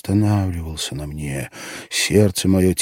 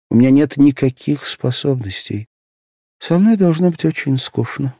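put-on lightly raised voice of a self-doubting young girl; a girl confessing her faults, slightly higher; young girl's voice; 5 semitones higher, 4 dB louder than usual. A narrator's reading. Asya's voice in narration.

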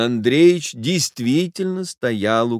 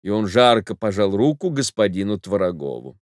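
usual speaking voice

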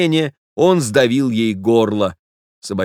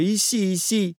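high, soft call to a little dog; lady calling out; 10 semitones higher, 4 dB quieter than usual. A narrator's reading. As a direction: coax the dog over in a high, light voice. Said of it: "закричала хозяйка".

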